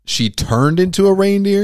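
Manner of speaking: loud, high-pitched real disbelief; incredulous surprise. Real, not acted. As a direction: blurt it out, astonished. Shocked by movie plot twist.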